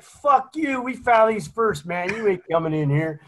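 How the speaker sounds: high-pitched indignant comeback, easing down; acted defiant retort. Occupant refusing Marines locked outside, defiant high-pitched retort. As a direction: fire back in mock defiance, starting high.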